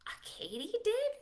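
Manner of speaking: amazed at the word; astonished rising question; surprised gasp. Acted. Surprised reaction.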